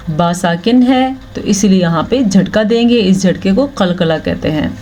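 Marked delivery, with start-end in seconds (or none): none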